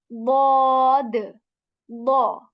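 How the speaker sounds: "bod" loud and very slow, full mouth; heavy letter. Shown as a demonstration. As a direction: draw out "bod" heavily. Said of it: "The second is a heavy alphabet".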